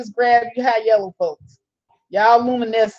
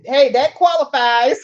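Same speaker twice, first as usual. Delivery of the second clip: usual speaking voice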